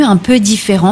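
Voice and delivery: ordinary talk, nothing marked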